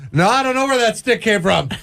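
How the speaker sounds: high-pitched kid playing innocent; mock innocent denial. Host acts a kid denying guilt.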